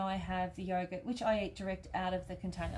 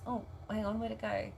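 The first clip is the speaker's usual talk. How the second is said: ordinary talk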